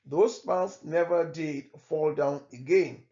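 plain reading voice

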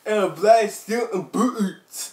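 a hint of a movie-star impression, high and slow; put-on reading voice. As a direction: read it like a hammy film star. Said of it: "He sounds like Downey Junior".

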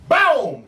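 high boom, falling sharply; imitated gunshot. A narrator's reading. Storyteller imitates bullet hitting Bloody Knife, sudden boom. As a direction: cut in with a sudden bang.

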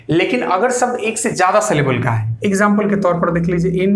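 ordinary talk

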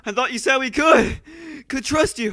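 loud, hurt accusation; angry. Acted. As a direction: accuse them angrily. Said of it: "angry doesn't have an exclamation mark".

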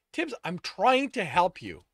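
loud, high insistent appeal; pleading. A narrator's reading. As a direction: urge them desperately to accept help. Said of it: "Sto pleaded".

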